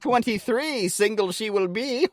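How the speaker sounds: high, loud sing-song rhyme; mock prophecy. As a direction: chant it like a fortune teller.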